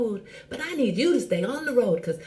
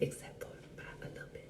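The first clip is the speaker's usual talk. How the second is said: whisper; 19 dB quieter than usual. Acted. Joking aside about judgment.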